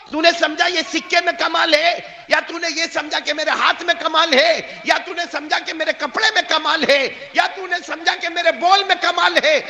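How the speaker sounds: loud, high thundering challenge; shouted rebuke. Acted. Saint rebukes the pilgrim over the coin, shouting. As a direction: hammer each question home.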